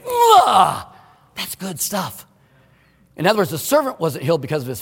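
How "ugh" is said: delighted grunt. Real, not acted. Relishes Jesus' words.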